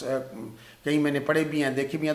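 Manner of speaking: ordinary talk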